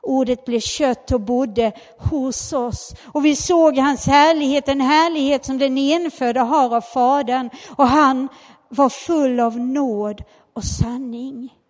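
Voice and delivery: loud proclaiming Bible reading; scripture reading. Acted. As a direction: declare the verse to the church. Reads John 1:14 aloud.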